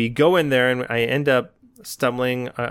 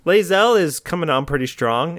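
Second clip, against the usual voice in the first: ordinary delivery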